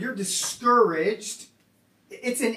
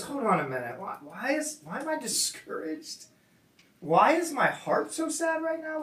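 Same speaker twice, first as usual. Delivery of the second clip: higher, softer, dawning realization; sudden realization; 4 semitones higher, 6 dB quieter than usual. Retold or imitated. Psalmist realizes his hope is misplaced, higher and softer. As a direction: voice the psalmist catching himself mid-thought. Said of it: "he has this realization where he goes".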